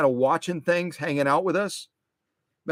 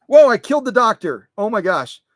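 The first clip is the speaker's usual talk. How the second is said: startled, higher, louder whoa; startled gasp of surprise; surprised; 5 semitones higher, 7 dB louder than usual. Real, not acted. Figure knocked over.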